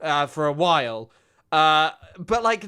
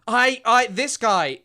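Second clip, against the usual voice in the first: usual speaking voice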